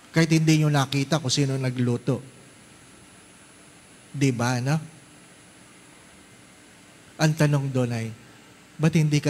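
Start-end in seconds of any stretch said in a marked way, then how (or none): none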